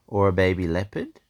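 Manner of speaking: ordinary delivery, nothing marked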